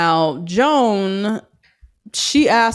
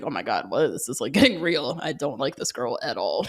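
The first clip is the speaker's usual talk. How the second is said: low, hushed aside of mild dismay; retold private realization; 6 semitones lower, 7 dB quieter than usual. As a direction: imitate someone quietly admitting it to himself. Retells his private dawning dread.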